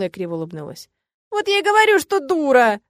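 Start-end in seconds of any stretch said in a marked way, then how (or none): 1.3-2.8 high, loud whine; tearful high-pitched outburst; wailing self-reproach. A narrator's reading. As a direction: lament it, self-pitying. Heroine wails self-reproach.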